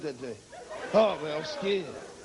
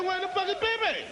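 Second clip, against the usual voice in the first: high, exasperated where is Bebe; a sharp disbelieving outburst; incredulous demand; 8 semitones higher than usual. Retold or imitated. Man at the park, shocked, pitched high. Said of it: "He said".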